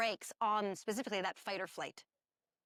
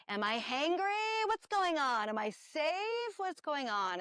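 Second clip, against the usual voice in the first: pitched-up, worried self-questioning; anxious inner voice; 7 semitones higher than usual. Retold or imitated. Speaker voicing a stressed person's inner alarm, mock anxious. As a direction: ask yourself in a rising panic, a touch hammed-up.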